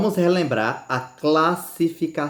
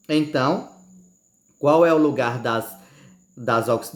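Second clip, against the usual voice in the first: ordinary talk, nothing marked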